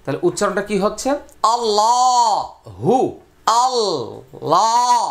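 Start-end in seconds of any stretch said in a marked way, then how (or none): 1.5-5.0 "আল্লাহু" with a thick, full-mouthed lam, slow and drawn out; heavy lam. Shown as a demonstration. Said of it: "দেখেন কিভাবে মোটা করছি".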